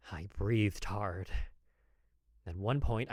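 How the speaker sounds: usual speaking voice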